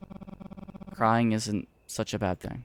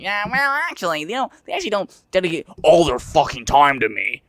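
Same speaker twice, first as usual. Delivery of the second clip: mocking impression; 7 semitones higher, 7 dB louder than usual. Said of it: "Just hearing that, like".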